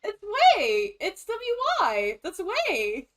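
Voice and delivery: high-pitched protesting correction; indignant insistence. Real, not acted. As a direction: insist on it, pitch leaping right up. Insisting in a pronunciation squabble.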